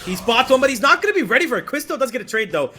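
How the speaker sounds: rising hype, fast and loud; esports caster. Real, not acted. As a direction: call the brawl with building excitement. Caster hype on a big play.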